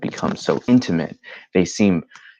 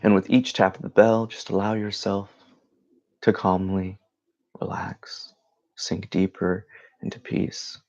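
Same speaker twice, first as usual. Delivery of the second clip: guided meditation. Acted. Leads a meditation.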